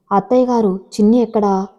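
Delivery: ordinary delivery, nothing marked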